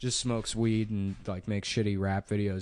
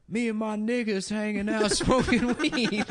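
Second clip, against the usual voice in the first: mock imitation; 10 semitones higher than usual. Imitating the kid's videos.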